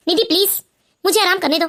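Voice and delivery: weary husband. Acted. Husband tells his wife he is not hungry, low and weary. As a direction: push the food away, worn down.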